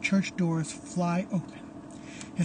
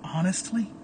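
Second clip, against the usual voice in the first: ordinary delivery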